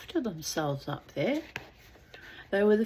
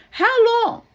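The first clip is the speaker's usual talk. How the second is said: desperate call; 13 dB louder than usual. Acted. Drowning man asks.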